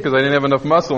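ordinary talk, nothing marked

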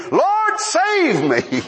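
high, long-drawn plea for rescue; panicked cry. Acted. Sinking believer calling to the Lord, panicked cry. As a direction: wail it out, stretching each word.

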